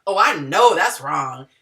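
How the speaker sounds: blunt correction. Shown as a demonstration. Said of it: "totally different than somebody going".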